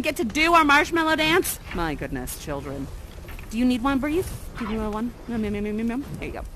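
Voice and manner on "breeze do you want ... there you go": ordinary delivery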